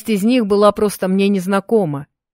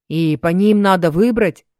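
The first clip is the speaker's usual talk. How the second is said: ordinary delivery, nothing marked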